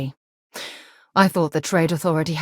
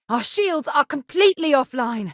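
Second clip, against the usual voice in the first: alarmed report; 9 semitones higher than usual. Acted. Combat alarm.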